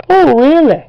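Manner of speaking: high, loud squealed sarcastic taunt; mock Shrek taunt. Playing Shrek taunting an enemy, loud high sneer. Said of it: "a bad Shrek voice".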